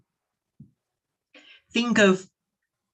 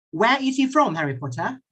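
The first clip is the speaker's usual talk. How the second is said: usual speaking voice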